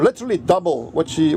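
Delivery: usual speaking voice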